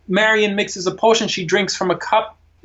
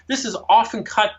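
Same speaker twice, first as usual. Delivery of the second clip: usual speaking voice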